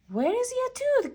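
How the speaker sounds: high, fast questioning; lightly voiced curious Pete; Pete's voice. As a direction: pop the question up high and quick. Pete asks Gus.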